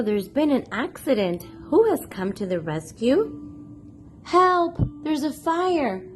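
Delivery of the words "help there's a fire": normal talking voice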